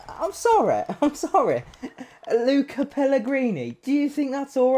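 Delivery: high, sheepish apologetic tone; sheepish apology. Real, not acted. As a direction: laugh off the blunder. Mispronouncing a player name.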